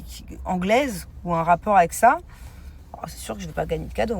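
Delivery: ordinary delivery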